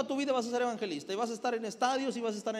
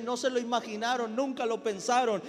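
louder, raised preaching voice; 3 semitones higher, 4 dB louder than usual. Acted. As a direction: preach it with rising fire. Preaching at full force. (predicación enérgica en voz alta)

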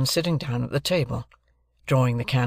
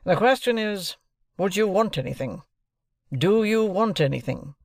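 raised, deliberate lawyer's voice; stern lawyer; 7 semitones higher than usual. Acted. Jaggers introducing himself to Joe and Pip, stern lawyer. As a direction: announce yourself like a cool London lawyer.

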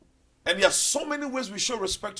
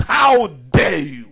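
slow, loud, anguished cry of outrage; Jesus roaring at the traders in the temple; screamed rebuke; 4 semitones lower, 9 dB louder, much slower than usual. Retold or imitated. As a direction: bellow it slowly, crying out in outrage. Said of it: "And he screamed".

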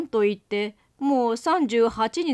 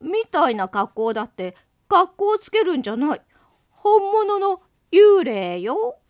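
higher, louder indignant snap; offended; 8 semitones higher, 6 dB louder than usual. Acted. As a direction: huff it out, insulted. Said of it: "相手は少しムッとしたようであった".

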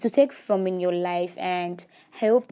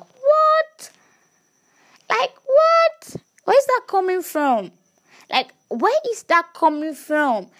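much higher and louder, shocked disbelief; incredulous what; 12 semitones higher, 5 dB louder, slower than usual. Real, not acted. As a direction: react as if you can't believe what you just heard. Incredulous reaction to her thought.

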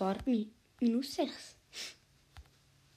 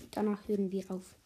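ordinary talk, nothing marked

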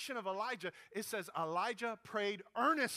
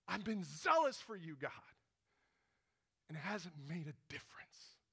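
zealous complaint, first time. Retold or imitated. Elijah answers God in the cave, zeal sinking to whisper. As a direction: protest that falls away to a whisper. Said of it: "after the second time Elijah said that".

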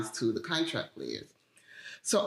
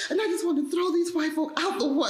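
high, heated retelling of her fury; mock-furious outburst, pitched high; retold anger; 13 semitones higher than usual. Telling her mom how angry the scene made her, high and heated. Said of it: "I was just so mad".

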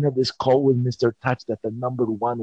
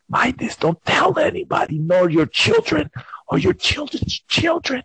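pleading; 13 semitones higher than usual. Retold or imitated. Urgent plea.

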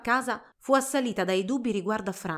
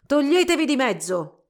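loud, raised, angry order; growled order; 5 semitones higher, 7 dB louder than usual. A narrator's reading. Old doctor ordering girls aside, growling angrily. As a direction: bark them out of your path. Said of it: "ringhiò marciando oltre".

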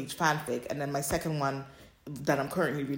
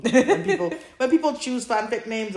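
laughing; 8 semitones higher, 6 dB louder than usual. Real, not acted. Laugh starting mid-sentence.

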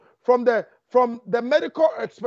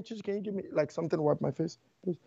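quiet aside; 7 semitones lower, 12 dB quieter, much faster than usual. Real, not acted. Aside asking for a towel.

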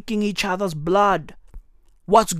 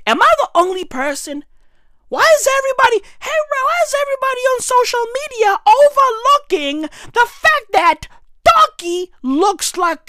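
fast high rant; 11 semitones higher, faster than usual. Real, not acted.

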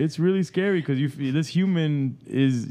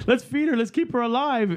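high, urgent mock panic; frantic parent; 10 semitones higher than usual. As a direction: play the overwhelmed parent, pitched up. Acted frantic parent caring for baby.

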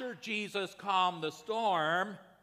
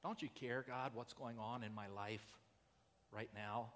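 hushed plea; 4 semitones lower, 10 dB quieter than usual. Retold or imitated. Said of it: "you've asked that question of Jesus".

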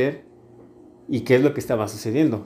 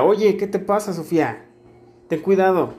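explosive scolding; 6 semitones higher than usual. Retold or imitated. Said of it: "siempre como que explotaba".

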